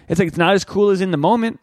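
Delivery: normal talking voice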